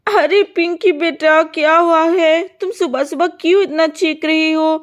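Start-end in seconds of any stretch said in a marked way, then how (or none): none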